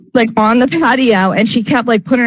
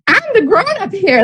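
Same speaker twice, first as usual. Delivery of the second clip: mocking high voice; 10 semitones higher than usual. Mock self-quote amid retold story.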